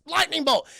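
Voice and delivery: high, loud mock battle cry; mock attack call. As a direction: call it out like casting a spell. Imitating Dooku's attack.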